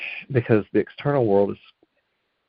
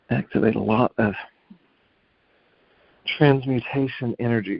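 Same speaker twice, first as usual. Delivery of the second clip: guided meditation; 4 semitones higher than usual. Acted. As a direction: ease them into stillness.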